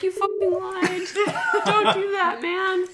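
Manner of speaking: high, loud, laughing outburst; laughing mock outrage. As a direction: call him out through laughter. Retold call-out, laughing.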